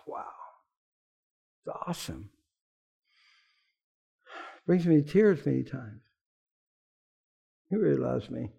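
low, soft, close to tears; hushed, moved testimony; tearful awe. Real, not acted. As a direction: let the emotion choke the words.

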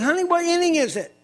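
clueless wife. Retold or imitated. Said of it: "came in one day and said".

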